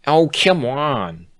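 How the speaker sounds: high, slow drawn-out plea; pleading. A narrator's reading. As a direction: whine it out coaxingly. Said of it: "Bon Bon pleaded".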